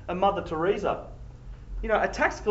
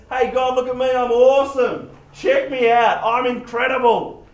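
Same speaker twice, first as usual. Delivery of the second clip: boastful prayer; 5 semitones higher, 6 dB louder than usual. Retold or imitated. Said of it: "his prayer is".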